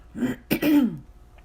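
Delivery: real loud throat clear. Real, not acted. Vlogger breaking off to clear her throat, throat clear. Interrupts herself, then apologises.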